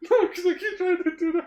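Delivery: high, laughing speech; explaining through laughter. Real, not acted. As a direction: try to explain while helplessly cracking up. Explaining while cracking up.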